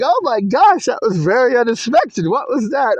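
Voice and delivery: high-pitched imitation of a startled grandmother; giggly grandmother surprise. Grandmother reacts to grandchild's sudden outburst, high startled voice. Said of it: "And she starts giggling".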